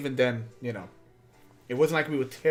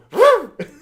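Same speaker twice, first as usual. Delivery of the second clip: loud, high-pitched teasing cackle; high spooky laugh; 14 dB louder than usual. Retold or imitated. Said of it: "I just make that noise".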